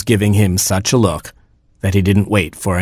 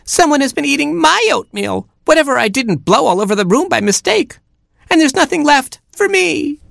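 very high, squeaky little chick; tiny chick voice, pitched way up; Baby Chick. A narrator's reading. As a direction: wail it like a tiny aggrieved chick. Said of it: "Baby Chick said".

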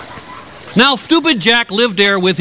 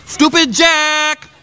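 calling out; 7 semitones higher, slower than usual. Acted. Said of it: "she call out to him".

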